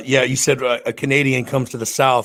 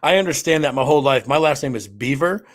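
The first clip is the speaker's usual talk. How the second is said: ordinary talk, nothing marked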